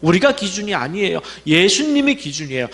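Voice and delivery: ordinary delivery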